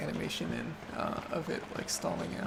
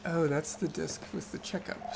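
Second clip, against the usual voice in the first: normal talking voice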